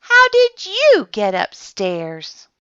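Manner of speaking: high, loud and slow; surprised question. A narrator's reading. Watchman finds the toy bear upstairs, slow surprised question.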